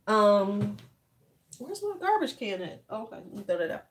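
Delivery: ordinary talk, nothing marked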